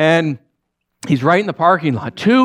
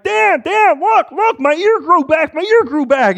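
high, loud, excited outburst; loud and giddy, pitched way up; excited Two-Eared Larry; 13 semitones higher, 3 dB louder than usual. Acted. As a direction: burst out with thrilled, high-pitched urgency. Excited man showing off ear.

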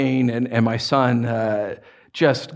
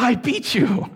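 high, laughing I beat you; laughing higher gloat; laughing mock boast; 9 semitones higher, slower than usual. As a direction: repeat the kid's gloat through a laugh. Re-saying son's boast laughing.

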